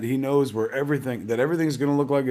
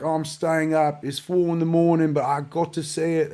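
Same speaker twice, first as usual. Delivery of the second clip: hammed-up British accent, pitched higher; mock a British fan in a higher voice; bad British accent; 6 semitones higher than usual. Imitating UK fans tweeting they're staying up, bad British accent. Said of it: "That's the worst British accent you've ever heard".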